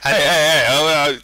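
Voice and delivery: loud, high mock outrage; loud protest. Real, not acted. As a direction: shout them down playfully. Protesting an interruption.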